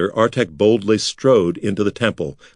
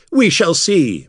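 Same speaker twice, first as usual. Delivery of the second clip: spat; 8 semitones higher, 3 dB louder, slower than usual. Acted. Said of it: "Makar spat".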